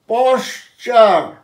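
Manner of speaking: পশ্চার slowly in two beats, posh-chaar; ending as "char". Shown as a demonstration. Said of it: "সবসময় উচ্চারণ চার হয়".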